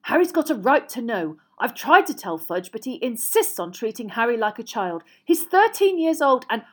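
insistent husband arguing; heated. A narrator's reading. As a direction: press the case with rising frustration. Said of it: "was saying heatedly".

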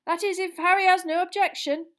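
a jolly official welcoming at full volume; booming Bagman. Acted. Jolly official loudly welcoming Harry into the room, booming and hearty.

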